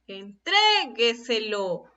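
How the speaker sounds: slow Entrégueselo, syllables drawn out, "tré" longest; stress on TRE. Shown as a demonstration. Teacher has students listen for the accent, "tré" held in Entrégueselo. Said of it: "A ver, escuchemos".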